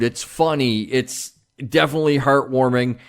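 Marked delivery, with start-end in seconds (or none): none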